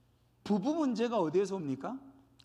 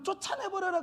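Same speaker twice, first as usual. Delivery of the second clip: mock command; 9 semitones higher than usual. Voicing God's command as gleeful expulsion.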